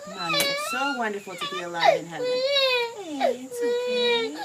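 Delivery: loud, high sobbing through the words; real tears breaking through every word; crying. Real, not acted. Mother sobbing while reading scripture to her son.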